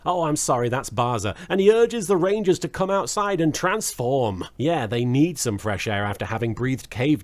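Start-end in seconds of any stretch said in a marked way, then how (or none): none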